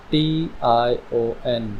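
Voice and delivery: slow letter-by-letter टी आई ओ एन; spelled out. Shown as a demonstration. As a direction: spell टी आई ओ एन slowly and softly. Teacher spells out an ending. (टी आई ओ एन, धीरे-धीरे एक-एक अक्षर)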